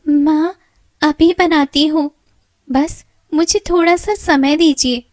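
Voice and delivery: put-on high pleading young girl voice; timid girl pleading. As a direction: beg for a little time. Meena pleads with stepmother.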